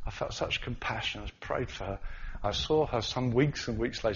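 ordinary talk, nothing marked

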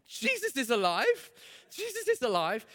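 put-on joyful little girl. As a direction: sing it out like a thrilled small girl. Girl voiced, overjoyed.

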